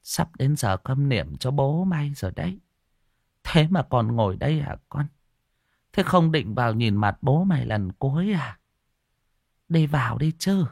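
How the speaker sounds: softly voiced old granny nudging someone along; old woman murmuring close. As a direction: lean into the ear and urge gently. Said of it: "Bà cụ ghé tai".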